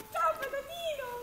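high cooing greeting. Real, not acted. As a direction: coo at the animal. Greeting the animals.

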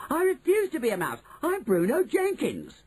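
put-on high, insistent boy's refusal; petulant high-pitched shouting; shouted tantrum. A narrator's reading. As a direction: stamp out each protest in a high voice. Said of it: "Bruno shouted, jumping up and down".

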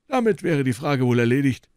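put-on a lofty old professor receiving guests; old professor's voice. A narrator's reading. Elderly professor politely greeting visitors, high and measured.

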